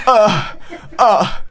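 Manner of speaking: imitated groan. Son imitates father groaning on the phone, mock groan. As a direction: groan helplessly in place of talking. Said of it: "うめき声しか".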